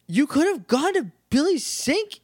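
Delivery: indignant, high-pitched protest; mock outrage. Viewer scolding the aliens for wasting everyone's effort, mock outrage. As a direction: complain like you've been badly cheated. Indignant rant at the shows' characters.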